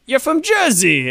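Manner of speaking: high, slow Jersey call; Jersey accent. Retold or imitated. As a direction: sing it out in broad Jersey. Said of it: "I was like".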